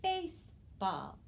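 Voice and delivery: "baseball" slowly split into base and ball; teacher modelling "baseball" slowly, part by part; syllable blending demo. Shown as a demonstration. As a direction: say "baseball" as two careful syllables with a gap. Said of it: "Our new word is".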